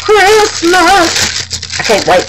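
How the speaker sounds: high, drawn-out excited cry; excited cheer. Real, not acted. Crafter who can't wait for Christmas theme, excited cheer. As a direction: belt it out with glee.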